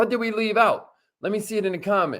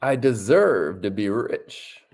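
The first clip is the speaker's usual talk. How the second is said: affirmation reading; 7 semitones lower than usual. Shown as a demonstration. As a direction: lead them, word by word. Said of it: "Say it with us".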